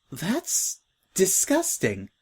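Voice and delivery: slow, drawn-out disgusted reply; a light grossed-out groan. Acted. New girl to Scratch, mildly disgusted.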